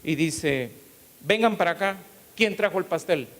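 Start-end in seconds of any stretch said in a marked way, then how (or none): 1.2-3.3 higher, louder summons; calling out. Retold or imitated. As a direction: call the staff over. Boss calls staff over. (jefe llamando a los empleados, más agudo y fuerte)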